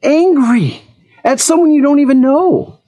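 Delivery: retold confrontation. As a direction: call him out on being upset. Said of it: "I'm like".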